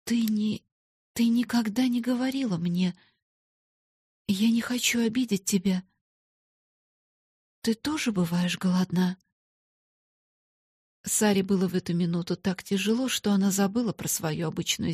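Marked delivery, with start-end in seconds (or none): 0.0-9.2 hesitant, halting question; mildly scared, careful voice; timid, frightened. A narrator's reading. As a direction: feel the way gently toward a painful question. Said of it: "робко сказала она с испугом".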